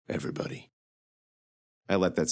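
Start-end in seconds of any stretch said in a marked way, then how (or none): 0.1-0.7 whispered, much softer, flat confirmation; grim whisper. Acted. Knight confirming the city's death, whispered. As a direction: breathe the word out, no voice.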